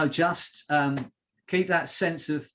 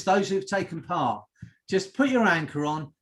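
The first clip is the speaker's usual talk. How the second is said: ordinary talk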